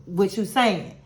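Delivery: normal talking voice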